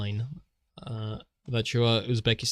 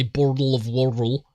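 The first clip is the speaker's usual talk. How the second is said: exaggerated American accent, louder, slurring the t's in "bottle of water"; imitated American accent; 5 dB louder than usual. Mocking how Americans say it, exaggerated American "bottle of water". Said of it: "but then they say".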